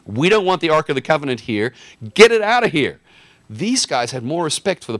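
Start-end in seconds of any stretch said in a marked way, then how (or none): 0.0-3.0 frantic Philistines. Retold or imitated. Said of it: "so they said".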